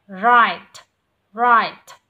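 Right said loud, ending on a plain t and no extra s; clean final t. Shown as a demonstration. Teacher modelling Right for the student, loud. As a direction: end Right crisply on t. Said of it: "đuôi của nó là âm thờ".

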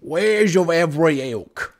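slow, loud mock British shopkeeper; British accent. Echoing the game merchant, hammed-up British accent. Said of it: "The British accent".

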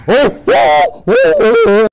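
loud, high startled cries; panicked yelps. Real, not acted. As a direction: yelp with each hit. Getting hit repeatedly.

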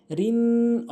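রিন with a held nasal n; ghunna. Shown as a demonstration. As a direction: hum on the end of রিন. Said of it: "অজীব গুণনা করতে হবে". (রিন গুন্নাহ করে)

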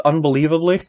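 usual speaking voice

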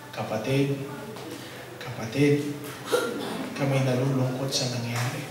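soft, hushed sympathy; hushed condolence. Retold or imitated. Fellow priests offering condolence.

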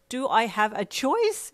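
high, loud, drawn-out retort; yelped. A narrator's reading. Pinned under a friend, high exasperated yelp. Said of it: "you yelped".

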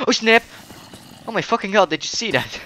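loud, high startled yell; startled shout. Real, not acted. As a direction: yell out in shock at the blast. Sudden explosion in game, yelling.

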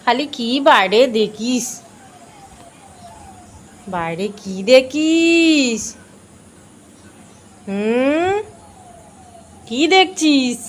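high, loud pet-talk to the animal; sing-song coaxing, drawn out. Real, not acted.

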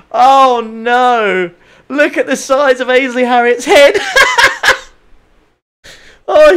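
real high-pitched, loud helpless laughter through the words; laughing hard. Real, not acted. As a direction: crack up and squeal it out between laughs. Cracking up at a livery.

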